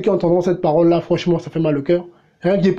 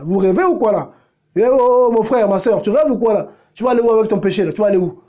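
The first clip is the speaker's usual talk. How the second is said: normal talking voice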